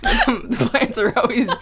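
high-pitched, giggling through the line; amused, words riding on laughter; laughing. Real, not acted. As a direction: struggle to talk through laughter. Cracks up over plants remark.

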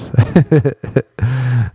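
chuckling at his own joke; real, pleased laugh; amused laugh. Real, not acted.

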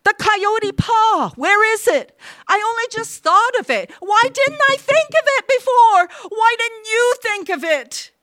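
frantic, very high, loud outburst; wild cry. A narrator's reading. Wife wakes her husband in the night, wildly shrill. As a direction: cry out in frenzied realization. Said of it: "his wife's wild cry".

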